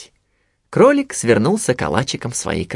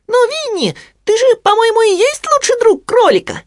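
very high squeaky piglet; Хрюня; 4 dB louder than usual. A narrator's reading. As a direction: pipe it up high and loud. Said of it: "задумчиво сказал Хрюня". (высокий писклявый голос поросёнка)

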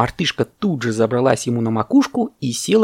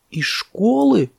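high, drawn-out gasp; aghast elephant calf; 11 semitones higher, much slower than usual. Acted. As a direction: stretch the question in shock. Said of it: "ахнул слонёнок".

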